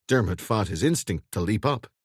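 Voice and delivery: usual speaking voice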